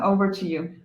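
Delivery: usual speaking voice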